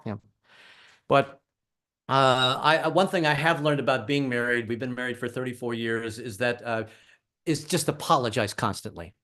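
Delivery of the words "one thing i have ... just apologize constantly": ordinary talk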